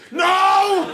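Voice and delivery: sudden high, loud refusal; panicked no. Retold or imitated. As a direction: blurt it out in mock panic. Refusing circus invite years later.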